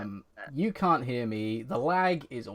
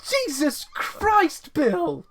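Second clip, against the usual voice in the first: high loud shocked outburst; startled exclamation; 14 semitones higher, 7 dB louder, much slower than usual. Real, not acted. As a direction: blurt it out in shock. Startled by Bill's mic mishap.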